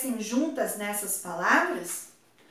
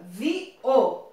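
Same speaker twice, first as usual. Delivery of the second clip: ordinary talk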